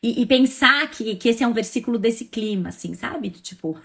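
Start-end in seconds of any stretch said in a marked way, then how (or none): none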